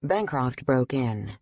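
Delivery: plain reading voice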